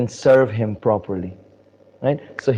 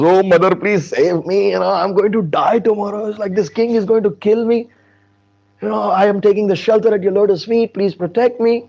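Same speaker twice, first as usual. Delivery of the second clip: high, wailing plea; hammed-up frightened supplication; acted pleading; 8 semitones higher, 3 dB louder than usual. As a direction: beg for your life in mock despair. King begs Hanuman's mother for protection.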